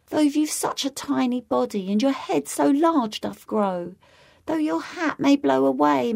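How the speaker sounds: a lady sadly turning down a suitor; sad lady's refusal. A narrator's reading. Lady Jingly sadly refusing the Yonghy Bonghy Bò, high and sorrowful. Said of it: "Lady Jingly answered sadly".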